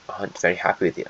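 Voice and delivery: ordinary delivery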